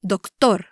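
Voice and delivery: "Doctor" said the Spanish way, dok-TOR; "Doctor" with emphasis on its ending; stress on last syllable. Shown as a demonstration. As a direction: lean on the final TOR of "Doctor". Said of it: "say the last part of the word louder".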